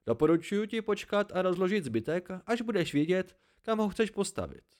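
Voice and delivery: put-on high-pitched, emphatic old hermit; lightly voiced hermit advising. (poustevník radí lehce zvýšeným hlasem)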